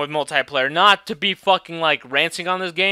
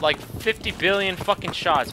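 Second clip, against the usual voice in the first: ordinary talk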